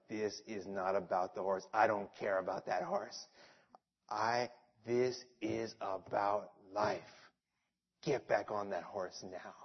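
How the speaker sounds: low, soft, grave aunt voice; quietly firm, deadly serious. Retold or imitated. Aunt insists nephew remount, low serious command. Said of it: "she was so serious".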